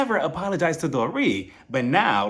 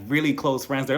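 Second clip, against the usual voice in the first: ordinary delivery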